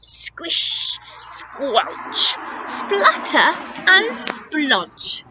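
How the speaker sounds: wet, hissing onomatopoeia; squelchy sound words. A narrator's reading. Storyteller voicing elephant's swamp crossing, squelchy sound effects. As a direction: relish each splashy word like its noise. Noises of wading through swamp.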